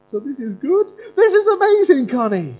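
joyful pastor. Retold or imitated. Pastor rejoicing with student over meeting Jesus. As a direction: celebrate her encounter with rising delight. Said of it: "I said".

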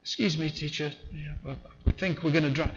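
soft, politely understated; mock-polite hushed request; mock polite calm. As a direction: ask as if it were no bother. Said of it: "Do you think they said it like that?".

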